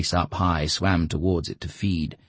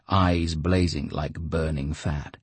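ordinary delivery

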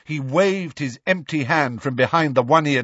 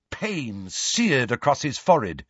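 ordinary delivery

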